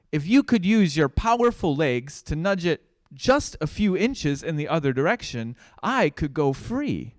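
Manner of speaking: a little mouse calling up to a giraffe. Acted. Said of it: "he shouted out".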